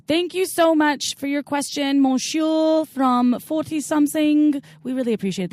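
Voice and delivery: mock French accent. Thanking listener Monsieur in a mock French accent. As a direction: play a hammy Frenchman saying thanks. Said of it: "That's my best French accent".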